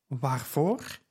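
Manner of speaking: a hushed, shaken father asks why; blanching with fear. Acted. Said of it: "vroeg Eumenes verblekend".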